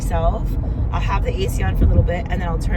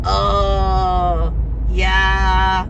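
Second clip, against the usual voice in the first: louder, odd drawn-out admission; sheepish guy; 5 dB louder than usual. Retold or imitated. Retelling the driver admitting he's taken, louder mock drawl. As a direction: play caught guy who admits it. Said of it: "and he goes".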